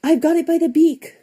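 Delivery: put-on baby bear crying out after finding Tacky; excited cry. A narrator's reading. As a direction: shout it in triumph, high and bright. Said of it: "Baby Bear cried".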